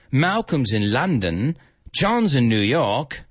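put-on British accent, measured line reading; British rocker accent. Band member in a taxi at a break-up, British accent. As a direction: play a British rocker in dialogue. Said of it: "I'm gonna try and do it with my accent".

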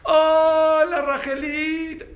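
sweet doting dad voice. Retold or imitated. Father on the phone to his little daughter, high loving coo. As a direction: gush over his little girl, voice way up. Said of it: "Cambió la voz totalmente".

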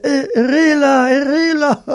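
high, drawn-out cry for help; comically wailed shout; mock desperate yell. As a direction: yell it long and high, hamming up the panic. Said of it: "el pobre gritando dice".

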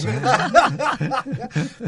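risa alta y espontánea; carcajada aguda. Real, not acted. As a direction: suelta una carcajada aguda y fuerte. Risa tras broma del libro. (carcajada aguda y sonora)

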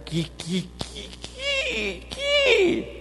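mock stutter climbing very high; exaggerated comic stammer; stuttering Moses. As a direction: stammer it out, jumping up in pitch. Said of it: "gente cree que Moisés decía".

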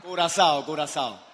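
loud, high announcing of each country to the room; booming roll call of countries; calling out to the crowd. Real, not acted.

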